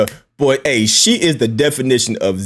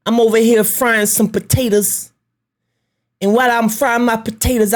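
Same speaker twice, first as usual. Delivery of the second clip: a much higher, far-off put-on voice of a chatty woman; impersonating Lonnie; 11 semitones higher than usual. Vlogger mimics the YouTuber greeting her viewers in a high voice. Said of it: "I got to impersonate you real quick".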